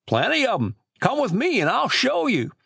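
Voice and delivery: put-on high-pitched rustic old man; old country storekeeper. Old storekeeper grumbling to boys about chicken thieves, high rustic voice.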